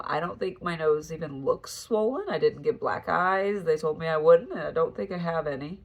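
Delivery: usual speaking voice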